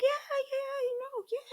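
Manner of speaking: nervous yeah. Retold or imitated. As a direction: squeak out a fake reassurance. Nervous cover-up when asked if okay.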